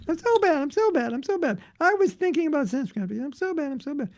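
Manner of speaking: high, whiny mock lament; self-pitying devotee. A devotee beating himself up over a thought, high and whiny. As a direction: wail it like a hammed-up guilty devotee. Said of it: "bang your head".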